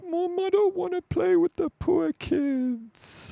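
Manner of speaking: put-on high, slow whiny child voice; kid voice. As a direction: mimic a spoiled little boy. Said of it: "and he's like".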